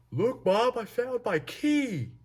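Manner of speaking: very high, loud, goofy holler; triumphant yell. Retold or imitated. Stoned friend turns round to announce his key, triumphant high yell.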